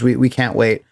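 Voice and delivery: ordinary talk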